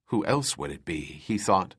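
usual speaking voice

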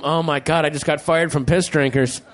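a touch of hammed-up despair; distraught fired employee. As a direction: whine like a man who just got fired. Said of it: "Then some guy has to go home".

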